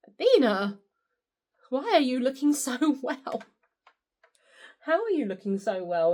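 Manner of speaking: lightly voiced astonished nurse; mock shocked nurse. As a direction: pretend nurse amazed, with a laugh. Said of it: "the nurses to almost be shocked".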